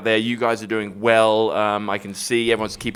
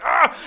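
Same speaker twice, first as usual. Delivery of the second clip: frustrated yell; 12 semitones higher than usual. Retold or imitated. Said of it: "You're like".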